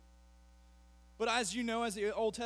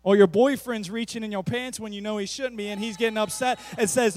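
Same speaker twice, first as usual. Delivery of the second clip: ordinary talk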